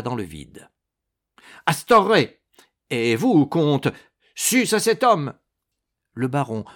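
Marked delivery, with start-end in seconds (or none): 1.7-5.3 high, loud battle call; urgent rallying cry. A narrator's reading. The monk calls baron and count to attack, shouting.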